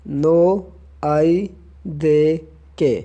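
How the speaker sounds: "नो आई दे के" slowly, a gap after each word; word-by-word breakdown. Shown as a demonstration. Teacher breaks the reply down for learners, word by word.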